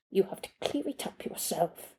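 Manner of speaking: dropped to a whisper at the end; hushed warning. Acted. Maid warning the boy to clean up, whispered. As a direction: whisper the rest, firmly. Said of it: "she said".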